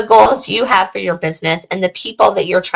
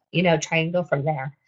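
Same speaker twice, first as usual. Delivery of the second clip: hoarse voice giving out, much softer; losing voice; 3 semitones lower, 10 dB quieter than usual. Real, not acted. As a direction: strain through a fading, husky voice. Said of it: "I'm losing my voice".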